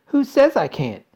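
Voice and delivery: high, loud challenge; defiant shout. A narrator's reading. Veruca defies Mister Wonka, shouting. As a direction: fling it back at him. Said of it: "shouted Veruca".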